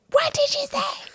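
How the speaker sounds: very high, slow mock-outraged squeal; high-pitched disbelieving mother impression; shocked mom. Outraged mother voiced in story.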